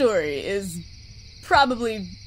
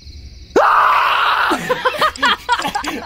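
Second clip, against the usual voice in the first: high shrieking laugh; scream-laugh at the jump scare; startled laughing scream; 13 semitones higher, 5 dB louder than usual. Real, not acted.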